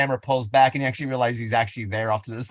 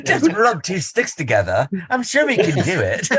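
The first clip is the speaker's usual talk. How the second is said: higher, hammed-up English accent with a laugh in it; posh English accent; 6 semitones higher than usual. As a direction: play a chirpy caveman in an English accent. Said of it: "massacred the English dialect for y'all".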